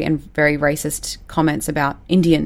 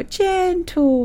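put-on much higher little-kid voice saying "Gentle"; a tiny child repeats "Gentle" in a high voice while patting the cat; little toddler copying; 13 semitones higher than usual. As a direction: echo "Gentle" the way a small nephew parrots his mum. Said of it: "my little nephew would go".

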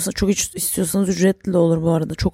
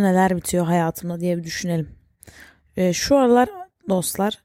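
normal talking voice

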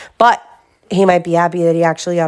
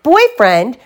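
higher, louder outraged rising cry; shouted; 6 semitones higher, 5 dB louder than usual. Acted. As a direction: throw the word back at him, louder now. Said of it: "shouted Sister".

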